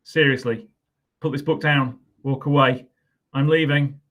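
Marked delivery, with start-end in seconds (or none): none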